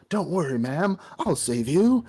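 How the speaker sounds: high-pitched, over-earnest hero voice; mock heroic. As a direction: play a rescuing hero, pitched well up. Voices the hero coming to rescue.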